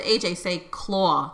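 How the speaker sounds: "claw" with a rounded aw, slow; husband's northern claw. Retold or imitated. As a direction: imitate his accent on "claw", slow and low. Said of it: "he said, he said".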